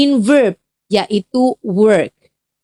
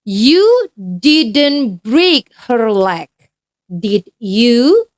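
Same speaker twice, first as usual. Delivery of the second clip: very slow, deliberate reading; slow careful example sentence; much slower than usual. Shown as a demonstration. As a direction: spell the example out word by word. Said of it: "Contoh kalimatnya seperti ini".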